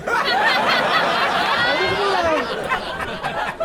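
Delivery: slow high swoon. Acted. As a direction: stretch it out like a lovesick sigh. Seductive drawn-out line.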